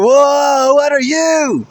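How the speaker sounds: high, loud frightened cry; startled shout. A narrator's reading. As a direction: jump at a scary mask. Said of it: "The mask frightened a sudden response".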